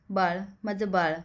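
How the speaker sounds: plain reading voice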